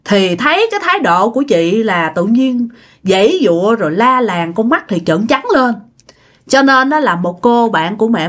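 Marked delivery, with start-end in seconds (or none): none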